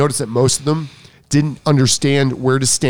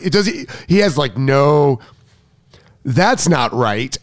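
ordinary delivery

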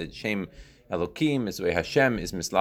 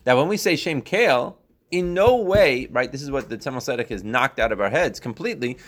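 ordinary talk

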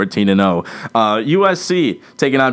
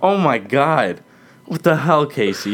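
mock shock; 5 semitones higher than usual. Scolding co-host over joke.

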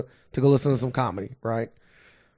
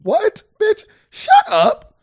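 very high, loud, incredulous; mock outrage; 7 dB louder, much slower than usual. Man answering the absurd complaint, mock outraged comeback. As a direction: squawk it back in mock anger.

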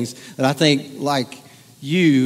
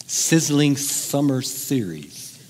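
very slow, word by word; savoured slowly; much slower than usual. Shown as a demonstration. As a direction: linger on every s in sizzling summer series. Repeats the phrase, savouring each word.

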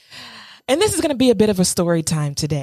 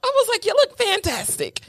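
high, gushing mock praise; hyping him up; 12 semitones higher than usual. Hyping up her date after his photos, high gushing praise. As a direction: pump him up like a cheerleader. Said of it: "I'm hyping him up".

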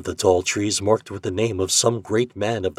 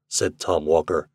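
ordinary delivery, nothing marked

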